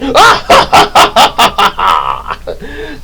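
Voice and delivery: loud, high villain cackle; long hammed-up evil laugh; Rita and Lord Zed evil laugh. Villain gloating over the next plan, cackling. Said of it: "like the way Rita and Lord Zed".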